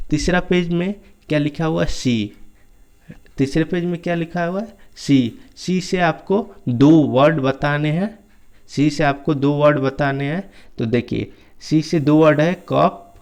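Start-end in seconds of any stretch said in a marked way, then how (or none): none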